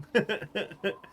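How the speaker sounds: short nervous giggle; a jittery little laugh. Real, not acted. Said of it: "That little nervous laugh".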